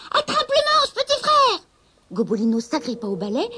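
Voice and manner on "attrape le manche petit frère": much higher, loud call from the broom; shouting. Acted. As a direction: yell it over the wind. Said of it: "lui cria".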